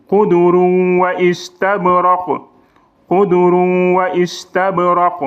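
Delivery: slow chanted recitation of Qudurun wa istabraq; Quranic recitation. Shown as a demonstration. Teacher chanting the verse, slow and melodic. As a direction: recite slowly, each word held, like a qari. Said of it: "on dit pas qudurun".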